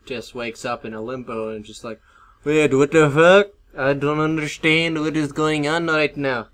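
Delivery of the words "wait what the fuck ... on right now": put-on Indian accent. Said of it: "I talk in Indian accent".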